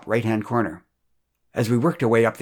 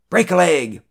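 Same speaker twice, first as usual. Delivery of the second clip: louder, higher call from the audience; shouted call; 6 semitones higher, 5 dB louder than usual. A narrator's reading. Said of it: "shouted out".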